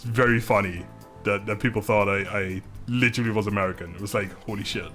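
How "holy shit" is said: normal talking voice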